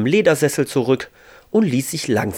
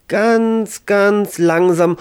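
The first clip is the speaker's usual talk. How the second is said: drawn out; 10 semitones higher, 5 dB louder, much slower than usual. A narrator's reading. Narrator draws out slow movement.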